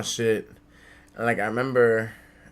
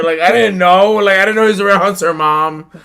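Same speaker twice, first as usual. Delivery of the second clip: high, loud, pleading excuse; mock frantic protest; 10 semitones higher, 16 dB louder than usual. Kid excusing the lost cards to his mom, comically frantic. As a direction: plead it loudly, pitched way up.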